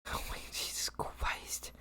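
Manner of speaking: breathy whisper of disbelief; whispered exasperation. Real, not acted. Streamer overwhelmed by chat screams, whispered disbelief. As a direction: let it out under your breath.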